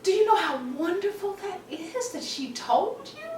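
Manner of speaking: imitated thrilled friend. Girlfriend praising the daughter for telling, imitated high gushing. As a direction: gush it high, playing the delighted friend.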